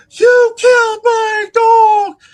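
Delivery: very high, drawn-out screaming wail; hysterical retold scream. Distraught owner at the driver in the street, hysterical shout. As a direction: scream it slowly, imitating a man gone berserk. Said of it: "now he goes ape shit".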